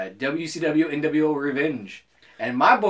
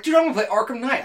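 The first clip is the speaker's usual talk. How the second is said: retold excitement; 8 semitones higher than usual. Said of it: "I got so excited".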